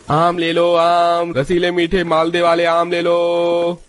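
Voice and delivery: high, drawn-out mango seller's cry; street hawker's call. Acted. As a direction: sing out the wares on one held high note. Fruit seller calling out wares.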